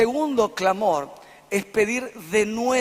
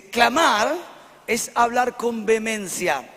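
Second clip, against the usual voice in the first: ordinary delivery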